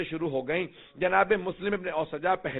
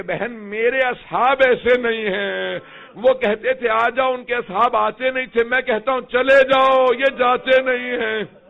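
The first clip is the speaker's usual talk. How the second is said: impassioned declaiming; 5 semitones higher, 7 dB louder than usual. Acted. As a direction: build it to a proud full-throated peak. Imam's proud reply, rising climax.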